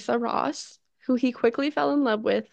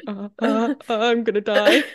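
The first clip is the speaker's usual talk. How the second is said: a hint of a pained listener, laughing through it; cringing listener who is half laughing; mock Hungarian listener; 5 dB louder than usual. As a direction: lightly act out a listener cringing in horror. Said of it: "Someone Hungarian is listening to this and going".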